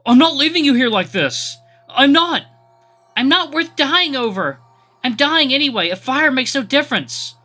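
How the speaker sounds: high, loud anguished yelling; desperate screaming. Acted. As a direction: scream it through tears. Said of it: "with feral screams".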